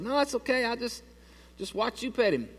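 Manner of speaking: high, tight, softening voice; nervous polite refusal. Retold or imitated. Nervous polite refusal near dog.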